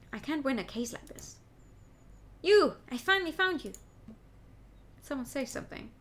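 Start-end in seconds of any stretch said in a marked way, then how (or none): 2.4-3.7 higher, louder shout of YOU; yelling at the lawyer he finally found, loud and high; accusing shout. Acted. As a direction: bark the accusation across the room. Villain confronts the lawyer.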